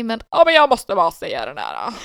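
loud, slipping into Värmland dialect; Värmland accent. Retold or imitated. Dad insists on telling his story, voiced in Värmland accent. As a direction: imitate dad with a stray Värmland lilt. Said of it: "nu börjar jag prata lite värmländska istället".

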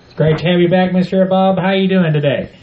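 ordinary talk

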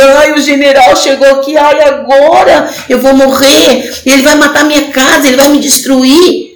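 mock panicked whining. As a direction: ham up a scared woman crying out. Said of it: "ela não ficou chorando".

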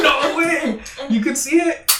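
high, amazed disbelief. Retold or imitated. Stoned guy hearing his friend sees the game too, high amazed disbelief. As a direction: gasp it out like you can't believe it. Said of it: "And I was like".

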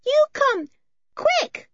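put-on high, loud and simple, childlike questions; a shy mountain girl asking in few words; wild girl's voice. Eileen urges them down.

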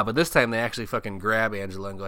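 ordinary talk

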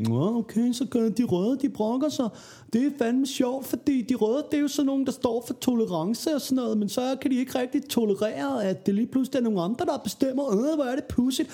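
high, sneering smug know-it-all; mocking smug liberal voice. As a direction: mimic a gloating smug liberal. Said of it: "og så kom alle de der liberale mennesker og sagde". (hånligt selvglad liberal stemme)

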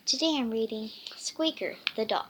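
ordinary delivery

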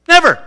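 Never thrown out high and loud; emphatic never. Real, not acted. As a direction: slam the word Never down. Preacher punches point.

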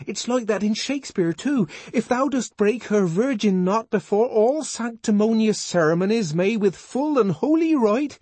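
put-on high, earnest young man's voice, pleading; John the Savage. John pleading with Lenina, high and fervent.

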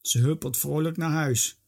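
ordinary delivery, nothing marked

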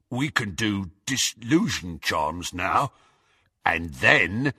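low grunted boast; grunting henchman. A narrator's reading. Goyle boasts of charms to Harry, low grunt. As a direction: keep it low, like a lout. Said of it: "grunted Goyle".

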